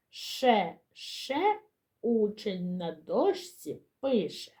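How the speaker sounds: put-on slow, measured children's chant; teacher modelling rhymes for children to echo; repeat-after-me syllable rhymes. Shown as a demonstration. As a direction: lift the syllables, then draw out each rhyme line. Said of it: "повторюйте за мною".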